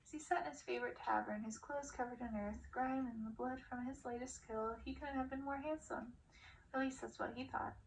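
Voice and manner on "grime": plain reading voice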